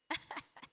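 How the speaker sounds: brief breathy burst of laughter; short laugh. Real, not acted. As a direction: let out a quick chuckle. Laughs after answering audience.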